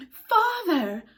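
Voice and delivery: high, loud shocked call; shocked cry. Acted. Wendy shocked at her father, crying out. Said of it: "Wendy cried, shocked".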